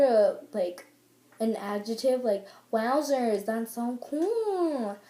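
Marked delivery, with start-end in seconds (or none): 2.7-5.0 slow, stretched-out exclamation; drawn-out awe. Shown as a demonstration. Showing wowzers as an adjective, drawn out. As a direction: hold the last word very long.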